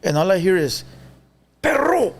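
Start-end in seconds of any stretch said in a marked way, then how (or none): 1.6-2.1 high-pitched holler across a room; imitated call-out. Uncle hollering to nephew at jail intake, high call-out. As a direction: sing out as the uncle calling. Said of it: "he used to say".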